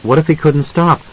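ordinary delivery, nothing marked

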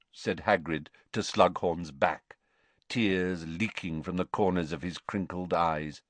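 ordinary delivery

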